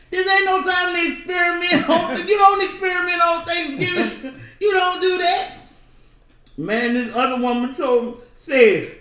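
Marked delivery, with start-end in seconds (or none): 0.1-5.5 high, loud, scandalized church lady; mock scolding shout. Woman in Dollar Tree scolding the narrator, loud and high. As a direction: shout it like she's appalled at the idea.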